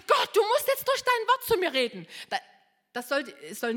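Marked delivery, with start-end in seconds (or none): 0.1-2.1 much higher and louder urgent plea; re-enacting an urgent prayer, high and loud. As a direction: call out to God urgently.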